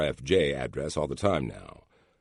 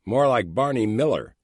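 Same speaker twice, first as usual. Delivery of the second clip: dry joking aside, higher; wry quip; 7 semitones higher, 6 dB louder than usual. A narrator's reading. Coworker quipping behind the narrator, wry. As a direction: toss off a wisecrack from behind. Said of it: "somebody quips".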